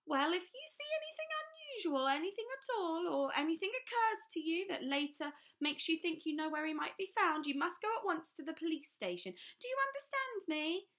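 talking down as if they had lost their wits; sinister official. A narrator's reading. Trapwood warns the children to go to the police.

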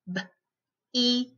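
b, then "eat" in separate sounds; sounded out. Shown as a demonstration. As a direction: break b, eat apart with pauses. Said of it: "We're gonna sound them out".

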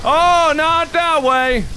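dismayed gamer yelp; alarmed cry. Real, not acted. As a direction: cry out as the game catches you. Caught out during game.

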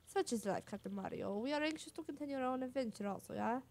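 put-on boastful Flavio accent, grandly declaimed; Flavio's accent. Flavio boasting of his glorious return to Rogueport, in his accent. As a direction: play up Flavio's accent like a vain adventurer. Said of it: "I've been doing Flavia's accent".

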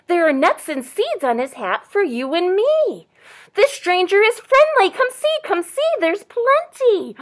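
high, loud, inviting bird voice; excited chickadee. A narrator's reading. Chickadee invites friends to food, high and excited. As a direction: call the others over excitedly. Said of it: "said the chickadee dee".

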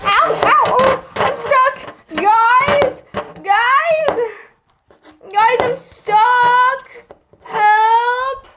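playfully stuck, calling out; calling for help. Acted. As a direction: wail for the friends to come help. Stuck under table calling friends.

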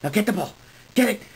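normal talking voice

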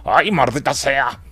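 slow, put-on dubbed voice; badly faked dub impression, drawn out; Adam Sandler. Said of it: "cómo hace la voz Adam Sandler".

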